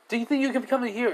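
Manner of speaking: high, fast question; mock eager reporter; pressing reporter. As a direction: fire it off quickly in a high voice. Reporter firing a question at hero.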